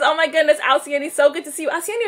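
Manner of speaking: usual speaking voice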